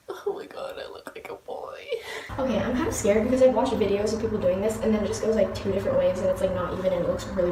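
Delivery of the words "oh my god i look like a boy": hushed, nearly voiceless disbelief; real shock, sinking into a whisper; dismayed whisper. Real, not acted. Dismayed reaction to short haircut.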